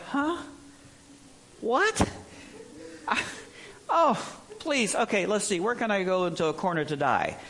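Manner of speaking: a dazed lamb looking for somewhere to die; confused newborn lamb. Pretend. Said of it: "they're like".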